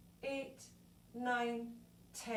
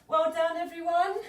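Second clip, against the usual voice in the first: cheerful praise; 5 semitones higher, 8 dB louder than usual. Real, not acted. Instructor praising the class.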